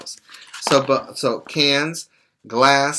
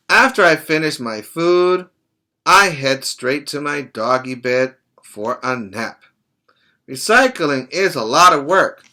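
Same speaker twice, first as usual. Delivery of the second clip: louder read-aloud, rising to the finish; story reading ending; 3 semitones higher, 5 dB louder than usual. Acted. Teacher finishing the book for the children, storybook ending. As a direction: round off the picture book for the class.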